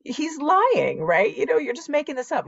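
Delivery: high, fast, disbelieving inner voice; incredulous inner voice. Retold or imitated. Thinking her brother is lying, high and fast disbelief. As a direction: rush it out like you refuse to believe it.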